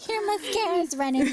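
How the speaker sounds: hammed-up snark; mock snide remark. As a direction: tease like a catty friend. Mocking tissue-giver.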